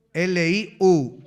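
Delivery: slow, spelling "L I U" letter by letter, each letter apart; spelled out letter by letter. Shown as a demonstration. Preacher spelling a name for the class, slow and clear. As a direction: spell "L I U" slowly so the class can write it. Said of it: "¿Cómo se escribe".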